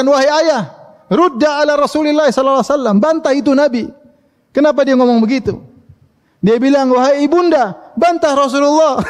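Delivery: high, urgent plea to her parents; a daughter's distressed high-pitched appeal; pleading Aisyah. Acted. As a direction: beg father and mother to speak up for her. Aisyah begs parents to defend her.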